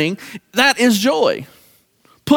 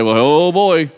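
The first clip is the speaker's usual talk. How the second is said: acted grumbling announcement, pitched low; mock weary dread; 5 semitones lower than usual. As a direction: lower the voice like someone dreading a visitor. Imagined dread as a complainer enters.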